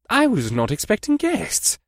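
high, slow pleasant general. Acted. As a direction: welcome them pleasantly, slowly. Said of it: "in a pleasant voice".

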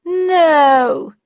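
high, loud, gloating "no"; laughing. A narrator's reading. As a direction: crow it as if laughing. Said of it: "she laughed".

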